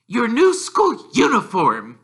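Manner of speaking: high, loud prim aunt voice; Aunt Petunia. Acted. Aunt answering Harry, high, loud prim reply. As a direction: pinch the words like a stern aunt. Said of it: "she said".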